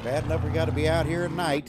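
loud complaint. Real, not acted. Grumbling loudly at the dog.

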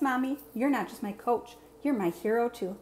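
ordinary delivery

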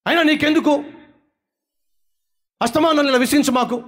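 high, indignant retort to mom; a teen snapping at the parents who question them. Acted. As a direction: toss it back with irritation.

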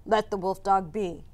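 firm, lightly voiced call from a hunter; lightly weighted voice of command; heavy voice. A narrator's reading. A stranger calls out to Tao to stop, in a lightly heavy voice. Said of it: "a heavy voice called out".

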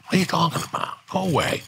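mock incredulous brush-off. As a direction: shoo him off in disbelief. Retold brush-off.

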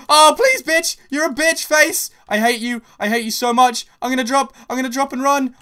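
high, loud yelling at the attackers; furious panicked yelling. Real, not acted. Gamer yelling at two teamers chasing her, high loud fury. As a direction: yell your hate at them while fleeing.

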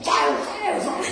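hammed-up shouts of fire; mock yell. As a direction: parody a careless fire-caller. Mocking overeager fire-calling.

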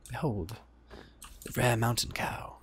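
hushed, halting attempt at a nature narrator; tentative British documentary voice, not quite landing; lightly attempted wildlife narrator; David Attenborough. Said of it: "get my David Attenborough voice on".

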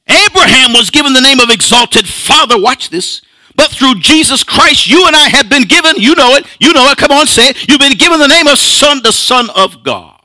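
high, quick, insistent preacher delivery; fervent preaching. Acted. Preacher urging congregation to say their new name, fervent. Preacher rises to full force.

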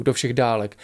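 usual speaking voice

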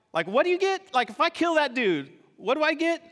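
high, eager, acted-out curiosity; eager questioning. David asking soldiers about the reward, eager. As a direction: ask keenly, pitch leaping up.

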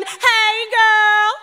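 high, loud put-on sassy voice; mock the fake friendly hey girl; sarcastic imitation of a casual greeting. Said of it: "Stop saying".

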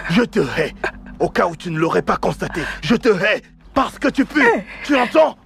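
climbing, high angry yelling; a husband shouting at his wife, furious and building; angry insults. Acted.

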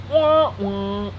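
flat, deadpan wah wah; monotone. Retold or imitated. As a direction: drone it without any tune. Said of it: "That was a monotone thing".

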